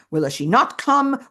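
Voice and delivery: anxious question, not punched; tearful and angry. Retold or imitated. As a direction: lean on not in anguish. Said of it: "Tearfully, but also angrily".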